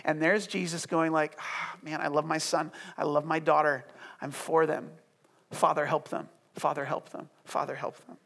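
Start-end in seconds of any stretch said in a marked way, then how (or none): 1.4-8.2 low, soft, heartfelt murmur; quietly pleading, almost to yourself; tender prayer. Acted. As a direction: pray gently for those you love. Voicing Jesus tenderly praying for people.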